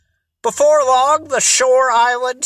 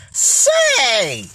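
sudden bright idea; 3 semitones higher than usual. A narrator's reading. Tony gets an idea for the search, suddenly excited. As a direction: exclaim as an idea strikes. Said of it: "Tony's face suddenly lighted up".

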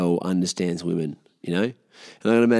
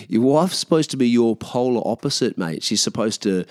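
usual speaking voice

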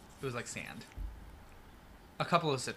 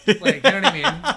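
laughing; 6 semitones higher, 13 dB louder than usual. Real, not acted. Laughing after a crude impression.

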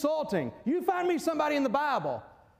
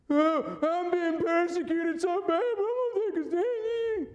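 high, whiny mock martyr voice; childishly sulky; 5 semitones higher than usual. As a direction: whine like a pouting child. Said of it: "petulance means childishly sulky".

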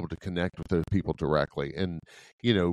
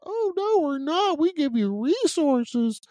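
very high, loud mocking squeak; bad Mickey Mouse falsetto, drawing out the end; 5 dB louder, slower than usual. Officer mocking state officials' claim of resources, squeaky Mickey Mouse voice. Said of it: "My worst Mickey Mouse voice".

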